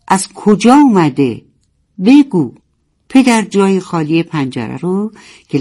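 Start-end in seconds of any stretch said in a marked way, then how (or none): 0.1-2.5 higher, louder narrated demand; insistent demand. A narrator's reading. Frightened narwhal pressing father about the bell, insistent. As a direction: press for an answer, raised voice.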